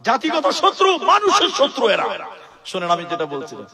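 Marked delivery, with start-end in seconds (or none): 0.1-2.1 indignant denunciation. Acted. Preacher denouncing such people.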